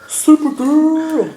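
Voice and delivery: high, loud, slow reveal of the name; game-show reveal of the contestant; big announcement. Acted. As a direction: stretch it out like a host unveiling a prize. Announcing number two.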